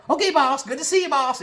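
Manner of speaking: very high, squealed eagerness; mock suck-up proudly delivering to the boss; fawning Bobby. Bobby leaving his boss, fawning.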